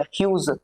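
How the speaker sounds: ह्यूज with a final j sound; corrected ह्यूज. Shown as a demonstration. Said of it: "ये जी बोला जाएगा".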